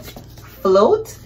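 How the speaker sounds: ordinary delivery, nothing marked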